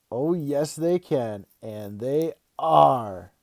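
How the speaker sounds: loud, building to a drawn-out last word; gleeful confirmation. Acted. Dad confirms his big news to his kid, triumphantly excited. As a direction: relish it and stretch the final word.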